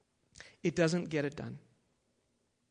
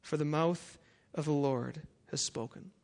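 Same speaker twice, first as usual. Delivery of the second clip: forceful scripture reading; 3 semitones higher than usual. Acted. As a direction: thunder out God's rebuke. Reads Isaiah at full force.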